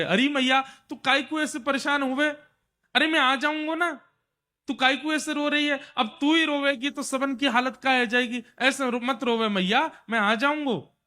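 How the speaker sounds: high-pitched acted child voice; little boy soothing his mother, high; child Krishna reassuring. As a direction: coax a weeping mother, pitched well up.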